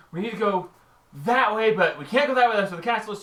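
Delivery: voicing the party. GM voices the party's thoughts.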